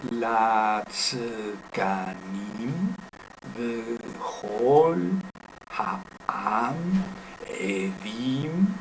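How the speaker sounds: drawn-out syllables with sustained vowels; careful, stretched-out demonstration reading; slow Hebrew reading. Shown as a demonstration. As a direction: read it out for learners, holding each syllable. Reads Hebrew verse syllable by syllable.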